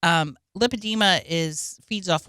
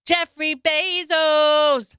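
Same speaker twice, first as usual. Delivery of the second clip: drawn-out call; 8 semitones higher, 5 dB louder, much slower than usual. Acted.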